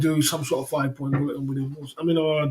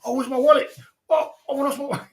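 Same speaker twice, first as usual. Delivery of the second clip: high, hammed-up panicky whine; mock whiny friend; 8 semitones higher than usual. Mocking his friend's fake panic over a lost wallet, high whine. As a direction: ham up a flustered guy hunting his wallet.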